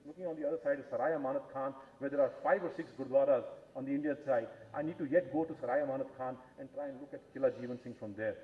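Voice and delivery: normal talking voice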